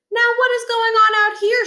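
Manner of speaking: high, lilting mom voice; a prim suburban mother at the door; voiced mother. A narrator's reading. Mrs Barkin voiced, high.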